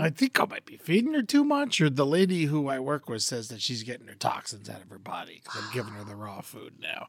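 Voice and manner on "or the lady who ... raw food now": whispered aside. Real, not acted. Quiet aside about dog.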